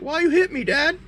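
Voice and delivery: very high, hurt little creature voice; squeaky mock-innocent baby dragon; doe-eyed baby dragon. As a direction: plead like a wounded baby pet. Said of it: "doughy-eyed".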